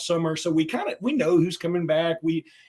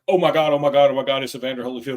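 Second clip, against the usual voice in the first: excited; 3 semitones lower, 5 dB louder, faster than usual. Retold or imitated. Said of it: "my brother's like".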